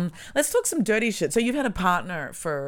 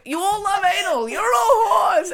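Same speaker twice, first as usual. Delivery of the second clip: high, loud, slow mock yell; shouted accusation; 8 dB louder, slower than usual. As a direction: bellow it at the crowd, slowly. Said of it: "So it's like".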